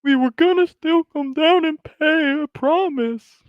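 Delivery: very high, slow mock kid voice; pleading kid. Caught kid promising staff they'd pay, high mock pleading. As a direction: squeal it out as a caught kid, hammed-up. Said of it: "one of the kids in the back's like".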